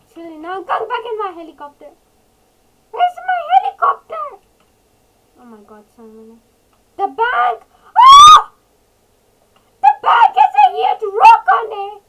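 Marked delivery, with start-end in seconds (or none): 2.9-4.5 high, loud frantic question; panicked shout. Real, not acted. Player searching for the lost helicopter, panicked shout. 7.0-12.0 high, loud horrified shriek; shocked screaming. Real, not acted. Player seeing a huge rock on the bank, shocked scream.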